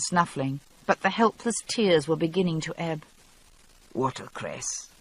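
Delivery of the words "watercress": muffled. A narrator's reading. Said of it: "voice slightly muffled by the leaves".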